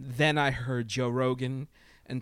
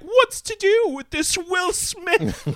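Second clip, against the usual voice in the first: much higher, louder, slow prissy scold; mock Karen voice; 9 dB louder, slower than usual. Mocking Karens who furrow their brows, in a high shrill slow scold. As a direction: squeal it like a scandalized Karen. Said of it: "who furrow their brows and they're like".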